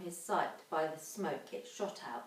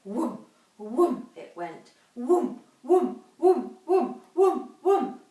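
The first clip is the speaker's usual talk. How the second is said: whooms climbing louder and higher; rising rhythmic engine noise; building whoom sounds; 5 semitones higher, 9 dB louder, slower than usual. A narrator's reading. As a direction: pump them out like a steam engine. Imitating the steam noise.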